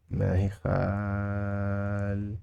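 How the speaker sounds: "خالقة" softly held long, last syllable detached; drawn-out teaching take. Shown as a demonstration. Teacher modelling we don't have it, drawn-out teaching take. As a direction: stretch "خالقة" and pause before its ending.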